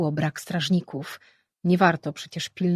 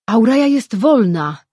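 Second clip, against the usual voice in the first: loud, high triumphant cry; powerful shout; 5 semitones higher, 10 dB louder than usual. A narrator's reading. Surim telling the others Auraja is free, powerful shout. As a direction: boom it out with joy. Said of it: "Głos Surima był tak potężny".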